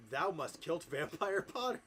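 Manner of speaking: put-on drawn-out hammy command in Old English; mock yell. Voldemort ordering Ebony to kill, slow mock yell. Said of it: "he yelled".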